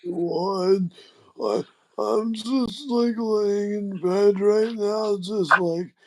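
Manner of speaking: put-on high, slow, creaky old man; a bored old man, drawn out. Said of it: "you're like".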